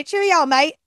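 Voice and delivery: lightly attempted English accent; a clumsy English accent as a joke; failed, barely-there English accent; bad English accent. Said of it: "speaking in an English accent".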